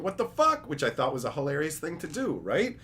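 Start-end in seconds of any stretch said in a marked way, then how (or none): 0.0-0.7 much higher, louder baffled yell; mock outrage. Him reacting to a card in a video, much higher baffled yell. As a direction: shout it in fake disbelief. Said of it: "me going".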